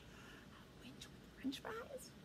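very soft, slow whisper; hushed disbelief. Pretend. Storyteller reacts to the dragon's menu, whispering in disbelief. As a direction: whisper it high, as a question.

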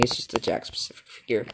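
hushed aside; whispering. Real, not acted. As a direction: drop to a whisper. Quiet aside after a joke.

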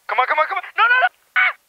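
high, loud panicked shouts; panicked yelling. Real, not acted. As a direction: scream as you fall. Falling to death in game.

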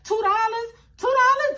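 high, loud, rising in disbelief; incredulous shout. Retold or imitated. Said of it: "I'm like".

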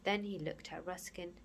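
usual speaking voice

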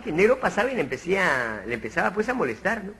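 normal talking voice

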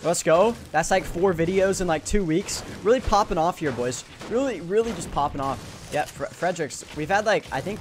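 loud high energy streamer hype; hyped announcement. Real, not acted. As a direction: pump up the chat with news.

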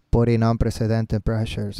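slow, low monotone reading; lifeless wrong way. Shown as a demonstration. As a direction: drone the words with no emotion. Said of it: "No, así no". (lectura monótona, lenta y grave)

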